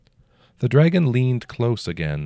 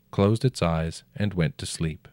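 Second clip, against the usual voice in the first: ordinary delivery, nothing marked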